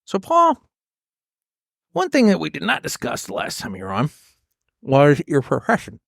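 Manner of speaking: talking around a cigar in the mouth. Real, not acted. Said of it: "I'm sorry, let me do that again, as I don't have a cigar in my mouth".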